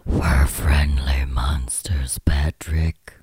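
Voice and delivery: slow, eerie stage whisper; whispering monster. Pretend. Monsters answering the co-host from the hatch, slow spooky whisper. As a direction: whisper it slowly like a monster below.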